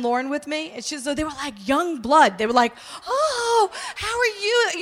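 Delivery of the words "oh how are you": imitating high, gushing welcome; mock a thrilled, high-pitched hello; excited greeting. Prayer group greeting the young newcomers, excited. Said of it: "they were so excited".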